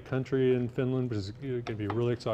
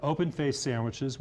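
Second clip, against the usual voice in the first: ordinary talk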